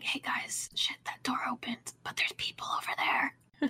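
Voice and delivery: hushed in-character whisper. Acted. Warning the party about people past the opened door, whispered. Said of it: "I whisper".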